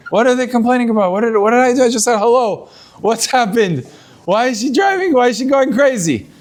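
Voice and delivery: mock-indignant husband, pitch lifted; bewildered protest. Acting bewildered husband after being lambasted.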